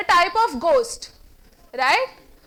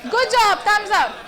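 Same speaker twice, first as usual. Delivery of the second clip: louder and higher praise; emphatic good job; 4 semitones higher, 6 dB louder than usual. Shown as a demonstration. Teacher demonstrating praise with a thumbs up. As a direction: cheer them on. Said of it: "So if I say this".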